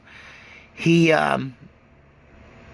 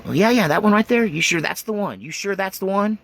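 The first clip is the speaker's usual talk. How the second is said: eager aunt; 8 semitones higher than usual. Retold or imitated. Aunt voiced, pressing again.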